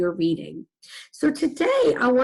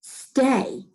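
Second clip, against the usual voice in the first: higher, firm pet command; 4 semitones higher than usual. Acted. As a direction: command it like a dog owner. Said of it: "in a firm voice".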